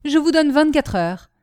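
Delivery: high, loud, forceful deadline; loud ultimatum. A narrator's reading. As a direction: lay down the terms forcefully. Michu gives Marion an ultimatum.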